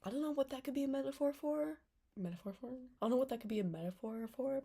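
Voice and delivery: high, loud mock puzzlement; exaggerated innocent wondering; feigned cluelessness. Mock cluelessness about obvious metaphor.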